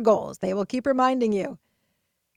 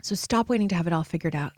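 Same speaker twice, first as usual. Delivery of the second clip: usual speaking voice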